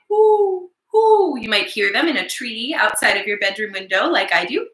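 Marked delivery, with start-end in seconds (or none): 0.1-1.4 high hooting owl calls. Shown as a demonstration. Teacher to kids, high owl hoots. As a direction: hoot like an owl. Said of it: "they might be saying".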